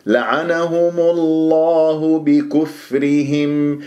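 لعنهم الله بكفرهم slow, heavy lam of الله; correct recitation. Shown as a demonstration. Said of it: "تكور هكذا الشفاه".

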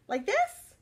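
high timid try; high uncertain little voice; unsure question. Acted. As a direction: ask shyly in a high voice. Crayon trying, unsure.

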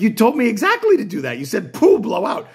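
patient protesting he followed instructions; indignant protest. Retold or imitated. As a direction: defend yourself quickly and indignantly. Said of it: "I was like".

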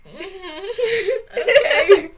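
high, laughing mock nod-along; laughing fake agreement. Newcomer pretending to follow the directions, laughing. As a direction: laugh through a pretend understanding. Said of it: "And I'm like".

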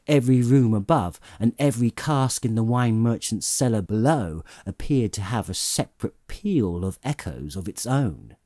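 usual speaking voice

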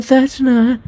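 high-pitched corrected character voice; corrected girl voice. Acted. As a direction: redo it in the right girl voice. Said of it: "I mix voices".

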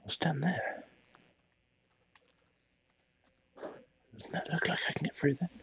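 nervous, hushed whisper. Real, not acted. Player peers into a dark passage, whispering. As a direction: whisper while peering into the dark. Exploring dark game area.

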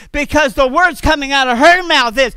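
usual speaking voice

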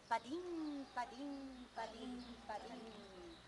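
light patter fading away step by step; tiny hoofbeats. A narrator's reading. Little goat's hooves on the bridge.

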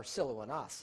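Siloanas as sil-oh-ah-NAHS; careful Greek Siloanas with AH vowels; correct pronunciation. Shown as a demonstration. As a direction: say Siloanas Greek-style, ending in an open NAHS. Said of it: "actually the correct pronunciation".

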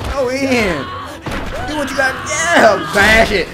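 high, loud urging; hyped-up spectator hollering; excited cheering. Real, not acted. Urging the hero on in battle.

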